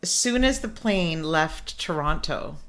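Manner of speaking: ordinary talk, nothing marked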